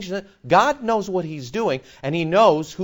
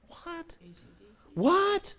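much higher, startled double what; mock bafflement; 13 semitones higher, 7 dB quieter than usual. Preacher replaying his reply to the union question, startled. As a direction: act out being caught off guard.

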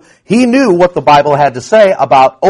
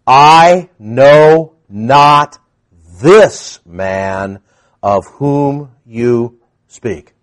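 slow denial; 4 semitones lower, much slower than usual. Acted. Peter's third denial, drawn out.

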